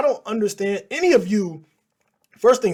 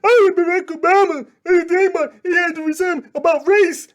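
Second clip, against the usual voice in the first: high, loud hammed-up mimic of a ranting pundit; mocking imitation; 11 semitones higher, 7 dB louder than usual. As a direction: parody his over-the-top yelling. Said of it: "this buffoon and his yelling".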